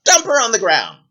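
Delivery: high, imperious order; bossy princess. Acted. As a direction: command it without pity. Princess orders guard about.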